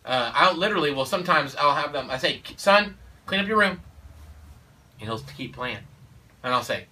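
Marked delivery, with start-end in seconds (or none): 2.6-3.8 loud, higher, commanding; firm father order. Retold or imitated. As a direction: give the order to the boy firmly. Father giving son an order.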